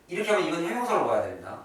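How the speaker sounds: usual speaking voice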